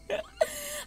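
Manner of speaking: breathless squeaky laugh; gasping high-pitched giggle; wheezy high laugh. Real, not acted. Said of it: "dry heaving and screaming".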